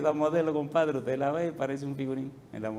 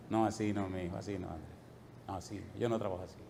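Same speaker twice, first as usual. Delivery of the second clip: soft, much quieter divine reply; gentle voice of God; 10 semitones lower, 12 dB quieter, faster than usual. Retold or imitated. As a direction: answer tenderly, like a patient father. God's voice answering softly. (voz de Dios, suave y bajita)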